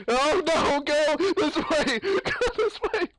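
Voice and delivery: high, loud panicked squeal; laughing panic squeal; panicked shriek. Real, not acted. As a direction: shriek at them to run this way. Panicked escape from Slender.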